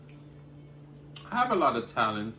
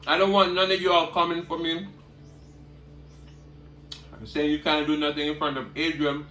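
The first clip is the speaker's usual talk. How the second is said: normal talking voice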